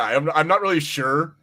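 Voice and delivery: ordinary talk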